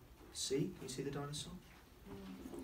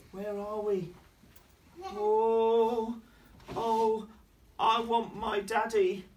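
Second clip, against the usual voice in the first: higher, slowing plea for daddy; scared little girl; 8 semitones higher, 6 dB louder, much slower than usual. Acted. Lost Lulu in dark wood, mildly scared higher plea. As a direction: sound lost and frightened. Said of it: "Lulu's saying".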